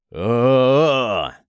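loud growling roar; growling yell. Acted. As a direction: growl it out in fury. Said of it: "зарычал Такеши".

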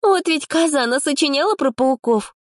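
high mocking snort of a line; high, sneering girl; scoffing. A narrator's reading. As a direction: scoff at the liar. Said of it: "хмыкнула".

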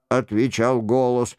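ordinary delivery, nothing marked

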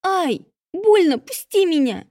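high, loud yelps of pain; crying out in pain. A narrator's reading. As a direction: shriek as if slapped. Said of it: "Сестра кричала".

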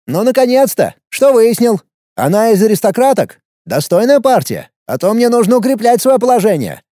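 high, eager questions. Acted. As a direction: perk up, impatient. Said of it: "спросил оживившийся парень".

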